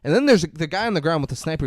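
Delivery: ordinary talk, nothing marked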